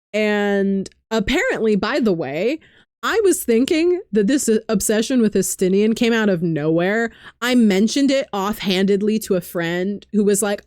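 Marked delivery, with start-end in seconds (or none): none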